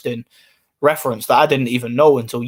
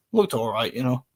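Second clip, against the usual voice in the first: ordinary delivery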